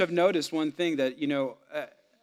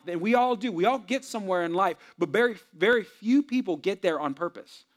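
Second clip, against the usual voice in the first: rising preaching, higher and louder toward the end; building sermon; 4 semitones higher, 4 dB louder than usual. Real, not acted. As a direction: preach it, climbing toward the close.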